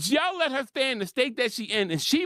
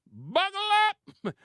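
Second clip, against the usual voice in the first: Buckle pitched far higher and slow, falling; high drawn-out buckle up; 12 semitones higher, slower than usual. Shown as a demonstration. Commentator warning viewers, high and slow.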